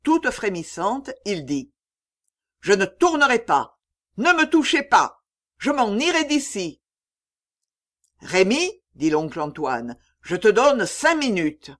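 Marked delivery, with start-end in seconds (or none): 2.6-6.8 high, loud, quivering defiance; a boy shaking with defiance; trembling defiance. A narrator's reading. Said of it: "de sa voix nette, toute frémissante". 8.2-8.8 stern call. A narrator's reading. Oncle appelle sévèrement. 10.2-11.7 stern ultimatum. A narrator's reading. Oncle lance un ultimatum.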